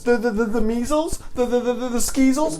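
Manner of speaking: put-on high stuttering kid; stammering. A narrator's reading. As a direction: stumble over the words. Stammering made-up disease.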